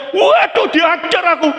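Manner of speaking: high, loud frightened yelp; panicked cry. Acted. Terrified mother hears her son calling, panicked high shout. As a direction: shriek it as if about to be beaten.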